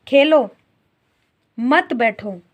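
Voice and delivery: खेलो punched, long pause, then मत बैठो; pause after Khelo. Shown as a demonstration. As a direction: stop after Khelo, keep मत बैठो together. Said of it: "विराम चिन्ह लगाए यहाँ पर". (खेलो के बाद विराम)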